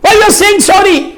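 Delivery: high, drawn-out mock challenge; people's incredulous objection. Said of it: "لوگ بولیں گے". (اونچی، کھنچی ہوئی حیرت بھری آواز)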